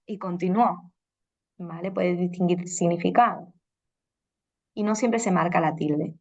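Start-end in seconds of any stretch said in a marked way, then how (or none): 0.2-0.9 "continuó" said slowly as con-ti-nu-Ó; stress on last syllable. Shown as a demonstration. Teacher contrasting meanings by accent, "continuó" stressed at the end. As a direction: hit the final Ó in "continuó". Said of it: "otro ejemplo de esto".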